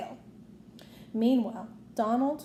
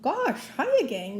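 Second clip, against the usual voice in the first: high, louder cheery cartoon mouse; Mickey; 4 semitones higher, 4 dB louder, slower than usual. A narrator's reading. Mickey, coming down in costume, greeting his friends cheerfully. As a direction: greet the gang brightly. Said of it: "Mickey said".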